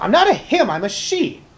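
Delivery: high-pitched gnome yelling; loud, squeaky-high outburst from an offended gnome; indignant yell. Acted. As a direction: yell it high, then rush on exasperated. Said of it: "the gnome yells out".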